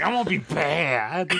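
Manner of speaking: mock villain vow. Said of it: "I was like".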